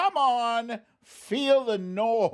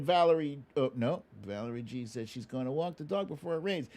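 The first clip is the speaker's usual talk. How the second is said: ordinary delivery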